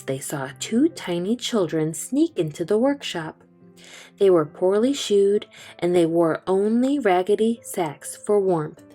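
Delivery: usual speaking voice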